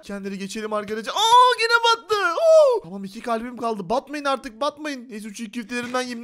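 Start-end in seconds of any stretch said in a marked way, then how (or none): 1.1-2.8 loud, slow, very high wail; alarmed scream. Pretend. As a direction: wail in panic as it sinks. Sinks again in game. (telaşlı, tiz feryat)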